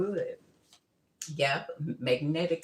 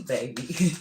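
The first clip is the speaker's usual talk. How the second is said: ordinary talk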